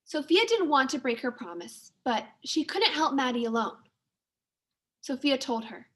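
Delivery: ordinary delivery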